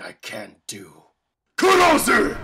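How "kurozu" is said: high, very loud attack call; attack shout. Acted. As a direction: roar the move name. Villain calls his attack.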